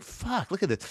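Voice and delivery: hushed curse, held back under the breath; muttered curse. Retold or imitated. Staring at the botched part, hushed restrained curse. Trying to control his temper.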